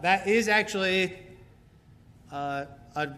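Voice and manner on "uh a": ordinary talk, nothing marked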